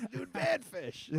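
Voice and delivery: slow stoner dude. Retold or imitated. Imitating fans recognizing the song.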